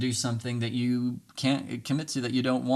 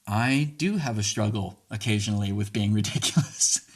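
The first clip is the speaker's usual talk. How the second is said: a laugh breaking into ridiculous; laughing mid-line. Shown as a demonstration. Modelling the reply, laughing while admitting it. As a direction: giggle through the word ridiculous. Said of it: "say it without laughing".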